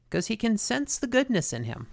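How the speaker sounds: normal talking voice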